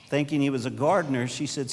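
ordinary talk, nothing marked

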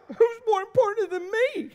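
high, whiny wounded outrage; hammed-up jealous squeal; mock indignation. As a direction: squeal it like a sulking, neglected husband. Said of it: "So I'm like".

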